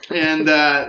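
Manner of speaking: ordinary delivery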